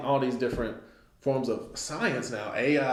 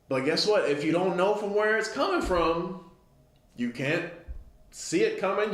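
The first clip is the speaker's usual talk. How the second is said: normal talking voice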